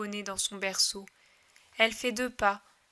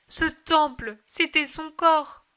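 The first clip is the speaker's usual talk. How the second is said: fervent insistence; 5 semitones higher, 5 dB louder, slower than usual. Acted. Mary pleading over Jesus' death.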